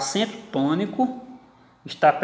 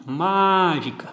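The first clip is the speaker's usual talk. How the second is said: mágica with MÁ drawn out; stress on first syllable; 2 semitones higher, much slower than usual. Shown as a demonstration. Teacher demonstrating antepenultimate stress, mágica with a long MÁ. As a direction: hold MÁ, then light gi-ca in mágica. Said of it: "antepenúltima".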